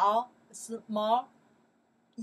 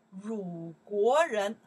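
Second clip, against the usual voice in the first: 鲁国人 drawn out slowly and louder, every syllable clear; slow stressed demo; 5 dB louder, much slower than usual. Shown as a demonstration. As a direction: model 鲁国人 slowly and loud for students. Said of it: "I say".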